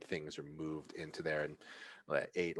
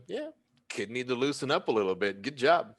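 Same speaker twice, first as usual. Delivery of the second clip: approving bouncer; 6 semitones higher, 4 dB louder than usual. Acted. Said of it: "looking and goes".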